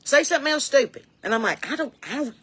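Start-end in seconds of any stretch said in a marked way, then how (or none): 0.0-1.0 high-pitched imitation of a challenging dad; daring dad. Dad cutting off a child's reply, mock challenge. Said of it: "he'll say something when you try to respond". 1.7-2.3 higher, softer mock-meek stammer; cowed stammer. Child answering a challenging dad, mock cowed.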